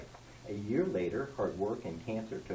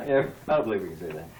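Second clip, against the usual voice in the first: laughing; 4 semitones lower, 5 dB louder, much faster than usual. Real, not acted. Laughing before reading poem.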